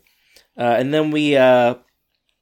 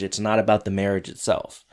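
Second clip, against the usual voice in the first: ordinary delivery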